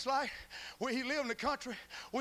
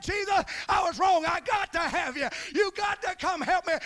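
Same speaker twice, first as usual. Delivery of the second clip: shouted plea, higher and louder; retelling the man's prayer at the altar, shouted; desperate prayer; 8 semitones higher, 8 dB louder than usual. Retold or imitated. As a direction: cry out to Jesus.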